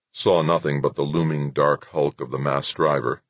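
plain reading voice

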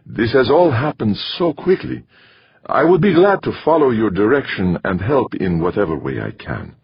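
polite newcomer figure. A narrator's reading. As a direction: voice an eager, courteous newcomer.

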